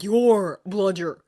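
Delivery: slow, loud, stunned anger; rising anger. A narrator's reading. Harry learning Dobby sent the bludger, rising anger. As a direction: spit the words out as the anger builds. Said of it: "anger rising".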